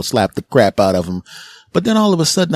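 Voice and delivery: usual speaking voice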